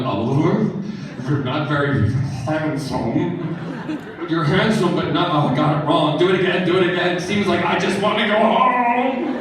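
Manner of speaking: giggling, words breaking into laughter; laughing through a retold line. Telling how he flubbed a compliment to Oliver, laughing. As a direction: retell the flubbed compliment while cracking up. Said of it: "And I'm like".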